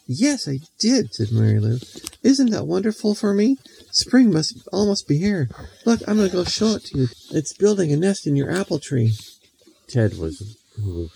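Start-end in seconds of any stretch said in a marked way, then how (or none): 0.0-1.1 put-on little girl character voice. 2.2-9.1 put-on high, bright-eyed showing-off girl voice; bragging little girl Mary Lou.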